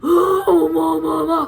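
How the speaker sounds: loud, high wail of joy; overwhelmed wail. Real, not acted. Wailing over the perfume.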